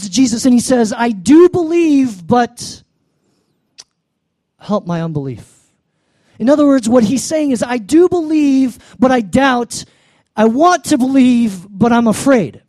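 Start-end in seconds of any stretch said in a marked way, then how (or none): 0.9-5.5 a hint of a desperate father, higher and louder; pleading father. 7.6-12.6 a hint of a desperate father, higher and louder; pleading father. Paraphrasing the father's plea.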